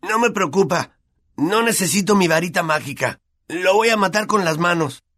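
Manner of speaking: high-pitched angry outburst without extra volume; Ron protesting. A narrator's reading. Ron held back from attacking Malfoy, furious protest. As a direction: push the pitch right up, straining to get at someone. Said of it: "protestó Ron cuando lo sujetaron".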